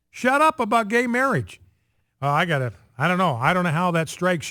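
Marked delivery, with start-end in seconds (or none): 0.1-1.6 high-pitched imitation of a scolding pastor; exaggerated retold rebuke, higher in pitch; mock holler. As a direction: mockingly holler it, pitched well up. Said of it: "holler at his colleagues".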